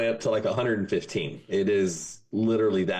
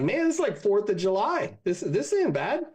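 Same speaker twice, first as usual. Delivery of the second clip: higher, easygoing and unbothered; Texas good ol' boy; 11 semitones higher than usual. Acted. Texas boys telling the Devil hell feels like home, higher and carefree. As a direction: shrug it off like it's a normal day.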